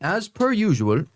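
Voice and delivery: a hint of a South African accent; light South African accent. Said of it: "try it in your best South African accent".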